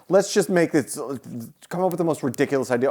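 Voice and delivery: mock filmmakers. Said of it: "There's like".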